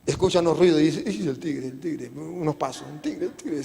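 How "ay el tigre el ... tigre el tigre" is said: hushed, acted fright at a tiger; much softer, as if afraid to be heard; trembling fear. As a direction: play it scared under the breath. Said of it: "se pone todo tembloso".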